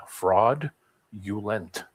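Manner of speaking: "Fraud. Ulent." as two separate pieces; split into parts. Shown as a demonstration. Friend spelling it out to check, word split in two. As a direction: lift "Fraud", pause, then drop on "Ulent". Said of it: "F R A U D U L E N T".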